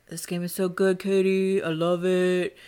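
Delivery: put-on voice imitating a co-host; impression of Jason. Friend teasingly mimicking co-host's plea to replay, put-on voice. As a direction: mimic your co-host urging you to replay. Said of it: "So that's my voice for you".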